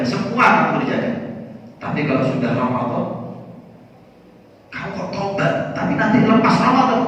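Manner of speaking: a teacher losing patience with a sinner; scolding kiai. Acted. The kiai scolds the young man. (kiai menegur dengan suara makin tinggi dan keras)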